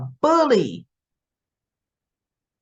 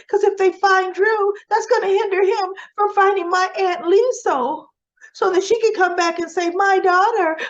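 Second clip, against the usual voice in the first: much higher, louder mock plea; mock Nina pleading; 10 semitones higher, 3 dB louder than usual. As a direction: ham up a gushing fake-worried plea. Mocking Nina's pleading inner voice.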